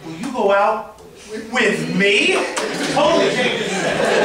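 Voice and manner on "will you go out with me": two-part ask with the punch landing on "me"; pause before with me. Shown as a demonstration. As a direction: break it, then push "with me". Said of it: "on the emphasis on a different word".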